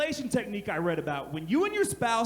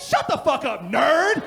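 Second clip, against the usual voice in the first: mock snap; 3 semitones higher, 7 dB louder, slower than usual. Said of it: "I went".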